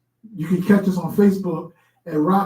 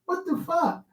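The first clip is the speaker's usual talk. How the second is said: mock comedian; 10 semitones higher, 7 dB quieter than usual. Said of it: "And he says".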